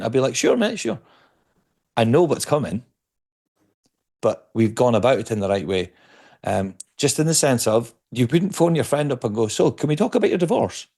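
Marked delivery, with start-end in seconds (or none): 0.3-1.0 high, bright eager yes to a pint; a friend instantly keen on a pint; eager agreement. Retold or imitated. Eager reply to pub invite. 9.5-10.9 mock blunt friend. Blunt awkward phone opener.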